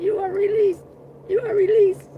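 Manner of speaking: high, prim doctor releasing her; squeaky mocking doctor. Imitating the doctor announcing her release. Doctor voiced in a retold scene.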